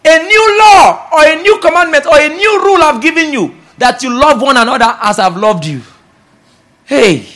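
loud, high, insistent proclamation; preaching at full force. Acted. Preacher tells congregation love is a law, preaching at full force. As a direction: hammer the new law into the congregation.

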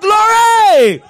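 high, very loud cry of Glory!; shouted glory. Shown as a demonstration. Preacher showing the church the right praise, a loud high Glory!. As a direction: belt Glory! out with joy. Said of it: "No, we shout".

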